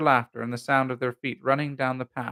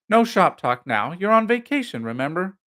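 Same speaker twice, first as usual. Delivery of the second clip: louder scolding reminder with rising pitch; impatient reproof; 6 semitones higher, 6 dB louder than usual. A narrator's reading. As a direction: scold a husband out of patience. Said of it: "could contain herself no longer".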